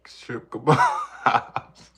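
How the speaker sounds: bursting into loud laughter on the words; loud, slow, helpless laugh-talk. Real, not acted. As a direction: crack up while saying it, drawn out. Cracking up over shrimp list.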